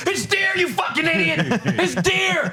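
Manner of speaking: high-pitched imitated outburst; mock meltdown, indignant and high; losing his mind. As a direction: shout the correction like an enraged kid. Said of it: "He'd lose his fucking mind".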